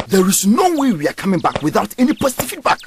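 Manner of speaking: ordinary talk, nothing marked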